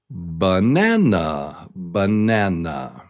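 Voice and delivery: slow banana, NAN on top; banana, strong middle, falling end; stress on middle syllable. Shown as a demonstration. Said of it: "is the top".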